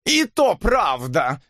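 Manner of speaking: high, drawn-out irritated screech; shrill wail. A narrator's reading. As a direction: burst in with a shrill cry. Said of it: "визгливо завопила она". (визгливый протяжный вопль)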